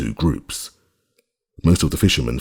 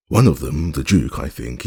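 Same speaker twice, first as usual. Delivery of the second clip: usual speaking voice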